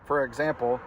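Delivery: ordinary delivery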